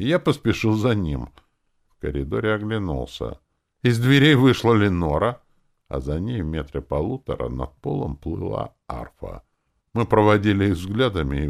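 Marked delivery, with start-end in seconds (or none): none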